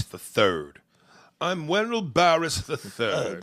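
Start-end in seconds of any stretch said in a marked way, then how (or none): 1.4-3.4 mock regal. Comedian mocking a numbered name, mock regal. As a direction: announce yourself like pretend royalty.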